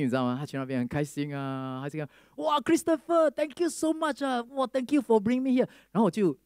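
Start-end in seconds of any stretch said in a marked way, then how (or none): 2.4-5.7 put-on grateful old uncle. Happy uncle thanking in English.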